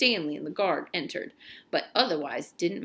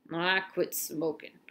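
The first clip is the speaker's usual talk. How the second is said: cowboy man voice; 3 semitones lower, 5 dB quieter, slower than usual. Acted. Cowboy-hat man speaks to guard.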